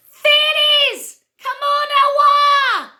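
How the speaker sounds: very high, loud fairy cry, slow on come on awa; shrill-pitched fairy summoning its friends; fairy shout. Acted. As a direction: call the fairy host like a tiny herald. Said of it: "where the fairy shouted".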